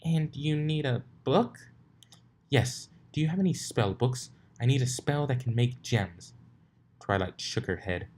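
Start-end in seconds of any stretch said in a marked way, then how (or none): 0.0-6.3 higher character voices; character dialogue. A narrator's reading. Twilight and Rarity talk about spell books, higher. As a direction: play the ponies' exchange.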